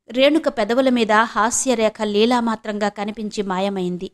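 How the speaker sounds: ordinary delivery, nothing marked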